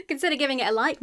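laugh, then rush into the request; real laugh spilling into speech. Real, not acted. Laughs as the sign-off begins.

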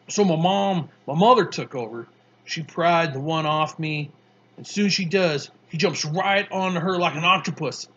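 put-on a tough kid, amused, spinning his story; Mars Bar voice.

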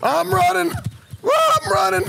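very high yell while fleeing; panicked shout. Acted. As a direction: cry it out in comic panic. Fleeing, answering partner's shouts.

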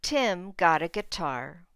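put-on clear, expressive read-aloud for small kids; reading to children. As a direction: read the picture book to the class. Teacher reads picture book aloud.

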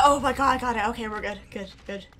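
ordinary delivery

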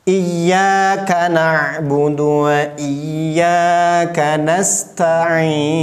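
very slow chanted Iyyaka na'budu; slow tajwid chant; Quran recitation. Shown as a demonstration. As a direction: draw the verse out as a recitation. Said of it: "Lanjut ayat yang kelima".

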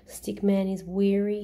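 usual speaking voice

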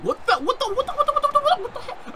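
very high, laughing squeaky stammer; worked-up squeaking. Real, not acted. Gamer losing it over a surprise intruder, worked-up squeaking. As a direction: repeat it in a sky-high squeal. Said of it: "I'm getting so worked up".

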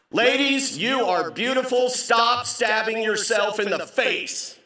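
high, loud comic appeal; exasperated plea. Acted. Comic begging ladies to stop piercing, exasperated plea. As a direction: plead it emphatically, drawing out the end.

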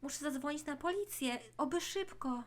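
normal talking voice

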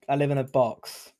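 normal talking voice

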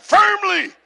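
high, emphatic one-word shout; firm shout. Shown as a demonstration. Preacher answering how you stand, firm shout. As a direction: punch it out with total conviction. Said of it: "And how do you stand?".

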